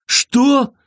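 high, horrified question; acted horror, no real shout; terrified cry. A narrator's reading. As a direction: jump up in pitch with alarm. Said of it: "закричал Сэм дрожащим от ужаса голосом".

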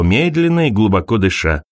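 plain reading voice

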